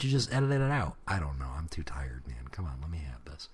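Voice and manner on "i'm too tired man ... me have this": breathy tired whisper. Pretend. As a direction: whisper it to yourself. Whispered self-reply.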